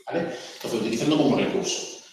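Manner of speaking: ordinary talk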